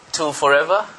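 usual speaking voice

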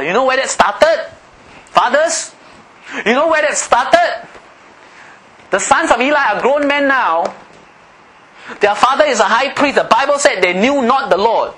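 high-pitched, forceful sermon delivery; impassioned preaching. Real, not acted. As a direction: preach it hard, pitched well up.

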